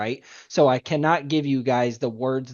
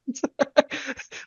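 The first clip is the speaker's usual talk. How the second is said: high laugh; 12 semitones higher than usual. Real, not acted. Laughs after the quote.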